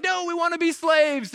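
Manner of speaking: high-pitched contrary whine; mock crying out. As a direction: imitate a people protesting. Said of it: "they're crying out".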